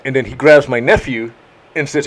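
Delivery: ordinary delivery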